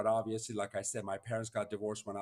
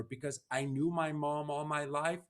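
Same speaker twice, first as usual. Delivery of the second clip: ordinary talk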